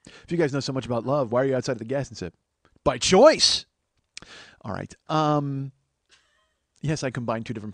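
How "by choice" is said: high, loud two-word retort; mock defiant comeback. Man outside Gas and Sip answers, mock defiant shout. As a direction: blurt it out defensively, hammed up.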